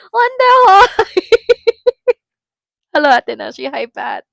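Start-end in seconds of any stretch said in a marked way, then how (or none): none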